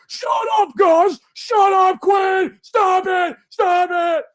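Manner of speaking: high-pitched, loud frantic shouts; re-enacted exasperated scream; screaming at dogs. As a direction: yell over barking dogs to silence them. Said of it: "screenshots of me screaming".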